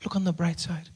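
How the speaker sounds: soft, gentle mock-weak voice; calm a crying child softly; soothing mother. Injured mother comforting her son, soft soothing voice. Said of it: "And she says".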